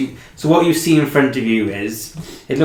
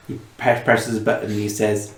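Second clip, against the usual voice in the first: normal talking voice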